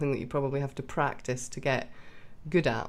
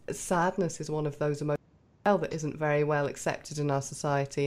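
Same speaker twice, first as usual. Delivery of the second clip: normal talking voice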